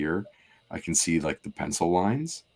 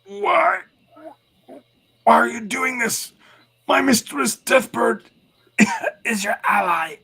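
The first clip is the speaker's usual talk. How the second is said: high, strained, panicked begging; desperate pleading; 8 dB louder, slower than usual. Acted. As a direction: beg for your life, shrieking. Fang pleading with the brood.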